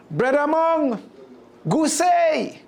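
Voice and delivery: high, eager patois greeting from a sly spider; high calling Jamaican trickster voice; Anansi calling out. Acted. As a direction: call out sweetly to butter him up.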